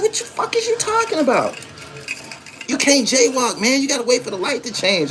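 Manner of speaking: high, bewildered retort, half hammed-up; incredulous high voice. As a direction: act stunned and offended by the rule. Mock argument over jaywalking.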